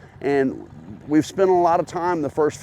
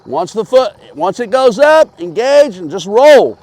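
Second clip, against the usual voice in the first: calling from far away; 7 semitones higher, 9 dB louder than usual. Real, not acted. Coach calling instructions across lot.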